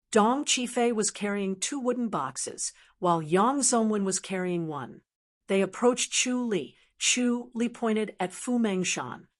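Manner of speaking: ordinary delivery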